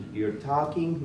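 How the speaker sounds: normal talking voice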